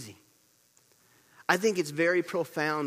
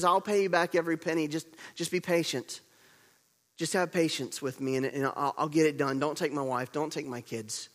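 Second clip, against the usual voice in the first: ordinary delivery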